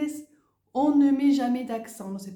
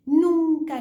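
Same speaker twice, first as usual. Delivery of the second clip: higher and louder, hammered home; emphatic never; 7 semitones higher, 8 dB louder than usual. Real, not acted. Teacher insisting to student the rule never breaks, emphatic. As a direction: slam down the word nunca.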